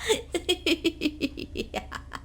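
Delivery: soft, high helpless giggle; giggling fit. Acted. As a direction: giggle and can't stop. Daughter-in-law's giggle fit. (बेबस खिलखिलाहट)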